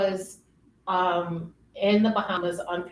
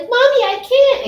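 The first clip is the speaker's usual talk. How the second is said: high, loud child's whine, imitated; frustrated little kid; 10 dB louder than usual. Mother retells her small daughter's struggle, frustrated little-kid voice. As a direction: mimic a little kid giving up. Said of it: "and get frustrated".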